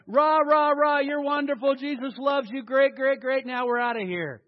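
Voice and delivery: loud, hammed-up pep rally; mock cheerleading. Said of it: "coming alongside and saying".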